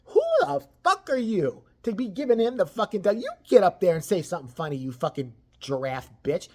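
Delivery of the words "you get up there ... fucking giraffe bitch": ordinary delivery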